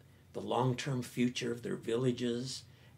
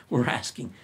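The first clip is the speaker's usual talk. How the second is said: usual speaking voice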